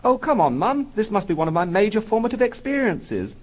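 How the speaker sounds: put-on high-pitched child's protest; whining little boy. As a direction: plead with Mum like a small boy. Small boy protesting to his mother.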